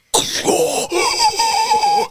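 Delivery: long, high-pitched mock gasp of shock; horrified waking gasp. Husband imitates partner waking up, huge high gasp. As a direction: gasp awake like Neo from the Matrix. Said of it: "like Neo waking up from the Matrix".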